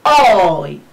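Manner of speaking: long, falling "oooy" glide; diphthong demo. Shown as a demonstration. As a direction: draw the sound out from aw into ee, as "oy". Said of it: "وهنا تنطق على شكل".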